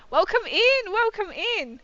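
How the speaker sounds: high, bright repeated welcome; excited greeting. Real, not acted. Streamer greeting arriving raiders, high and loud. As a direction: sing it out to the raiders.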